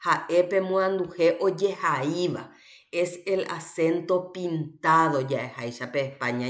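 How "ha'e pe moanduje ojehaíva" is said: normal talking voice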